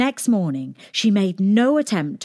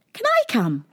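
eager princess; 11 semitones higher than usual. A narrator's reading.

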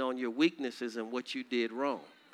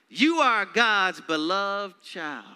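tender declaration; 5 semitones higher, 6 dB louder, slower than usual. Acted.

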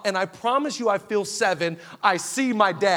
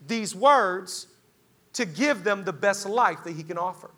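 ordinary talk, nothing marked

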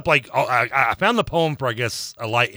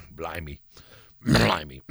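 low British accent warm-up; 6 semitones lower, slower than usual. Shown as a demonstration. Co-host warming up before reading a poem, British accent. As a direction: practise a British accent, clearing the throat. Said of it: "do it in a British accent".

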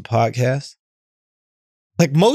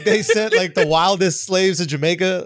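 ordinary talk

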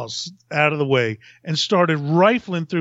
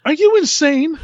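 incredulous scold; 11 semitones higher, 4 dB louder than usual. Retold or imitated. Coworker Glenn scolds him.

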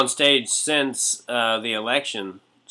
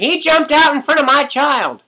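high, loud, shrill-edged parent protest; outraged complaining parent; 8 dB louder than usual. Pretend. Parent complaining to the haunted-house manager, high and loud. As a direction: play an indignant parent griping to the manager. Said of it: "Complaining to the manager".

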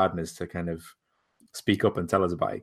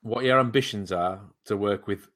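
ordinary talk

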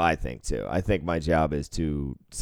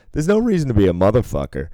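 higher, louder, fast insult; mock angry outburst; 5 semitones higher, 5 dB louder, faster than usual. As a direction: lash out at the dealer. Retold angry gambler insult.

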